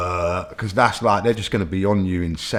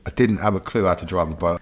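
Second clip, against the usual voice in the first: ordinary delivery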